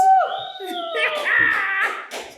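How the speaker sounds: loud high laugh. Real, not acted. Laugh after sign-off.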